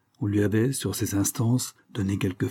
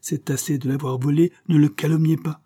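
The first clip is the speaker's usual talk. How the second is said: higher, indignant scolding; stern rebuke; 6 semitones higher, 4 dB louder than usual. A narrator's reading. Father defending the employer to his son, indignant. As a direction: scold with raised pitch.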